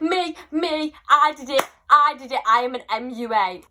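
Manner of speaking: loud, high, bursting with pride; eager excitement. Retold or imitated. Fifteen-year-old claiming credit for her makeup, loud excited re-enactment. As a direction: jump in shouting to claim the credit. Retold eager boasting to idol.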